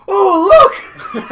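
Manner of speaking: very high, loud delighted squeal; excited little kid. Retold or imitated. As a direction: shriek it like a thrilled small child. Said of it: "my nephew comes down and goes".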